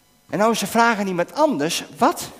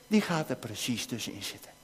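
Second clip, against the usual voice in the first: much softer and lower, almost confiding; hushed warning; 8 semitones lower, 12 dB quieter than usual. Acted. Preacher telling the children how the enemy interrupts, hushed. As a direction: let it sink in quietly, ominous. Repeats the point about the enemy.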